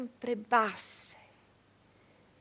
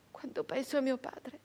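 ordinary delivery

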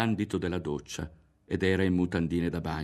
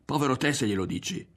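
higher, quickened warning; rushed, pitched-up threat; alarmed threat; 7 semitones higher, faster than usual. A narrator's reading. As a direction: warn them off in alarm. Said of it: "si allarmò Berto".

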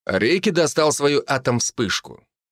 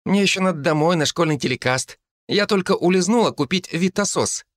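put-on little girl boasting, higher; proud child showing off; boastful; 7 semitones higher than usual. A narrator's reading. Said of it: "похвасталась она".

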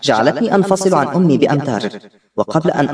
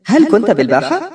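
higher-pitched surprised question; astonished; 5 semitones higher than usual. A narrator's reading. Girl asks Nadeem if he was in the square, astonished. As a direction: ask it in mild astonishment. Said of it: "قلت في دهشة".

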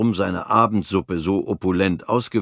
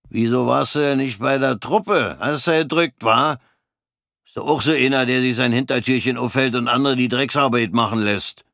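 high, louder jeering taunt; nasty provoking; 4 semitones higher, 3 dB louder than usual. Acted. Bald corporal turns nasty and taunts Fritz, high and loud. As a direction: bait him with malice. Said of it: "Er wurde bösartig".

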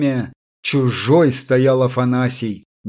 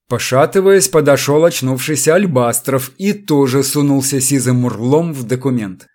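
ordinary delivery

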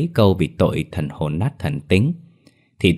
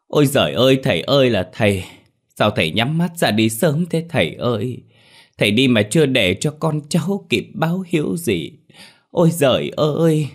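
mock wailing lament; 6 semitones higher than usual. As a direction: wail it like a hammed-up mourner. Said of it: "cứ thế mà gào lên". (gào khóc giả vờ, giọng cao)

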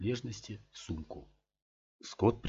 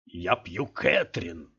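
slow, hammered-out furious declaration; slow raging inner shout; inner furious cry; 2 semitones lower, 3 dB louder, much slower than usual. A narrator's reading. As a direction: spit each word with drunken rage. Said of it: "яростно закричал его рассудок".

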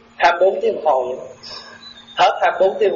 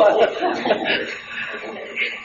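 breathless laugh; 5 semitones higher, 6 dB quieter than usual. Real, not acted. Laughs at his own joke.

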